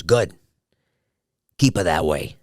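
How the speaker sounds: lightly voiced dad giving terse advice; understated imitation of a father's verdict; imitating dad. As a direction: give brief fatherly approval, with a pause. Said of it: "like that".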